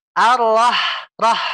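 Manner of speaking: Ar-Rah- with air pushed out on the h; breathy ha. Shown as a demonstration. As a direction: let the air flow on Rah. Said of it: "Anginnya keluar". (Ar-Rah dengan angin keluar)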